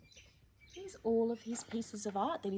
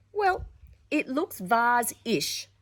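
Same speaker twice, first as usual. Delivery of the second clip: loud, slow delighted claim; exclaimed; 2 semitones higher, 7 dB louder, slower than usual. Acted. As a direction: proudly insist it's vase-ish. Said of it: "she exclaimed".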